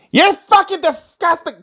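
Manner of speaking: high loud mocking jeer; heckler's jeer. Imagined viewer jeering at him, high and loud.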